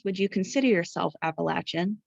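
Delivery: usual speaking voice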